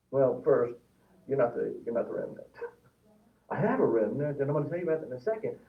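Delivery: normal talking voice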